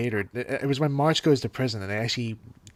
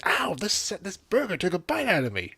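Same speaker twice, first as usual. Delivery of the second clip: customer complaint; 7 semitones higher than usual. Retold or imitated. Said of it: "this customer going".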